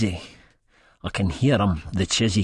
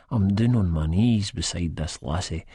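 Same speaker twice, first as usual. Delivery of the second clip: usual speaking voice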